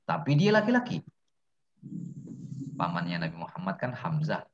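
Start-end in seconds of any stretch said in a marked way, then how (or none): none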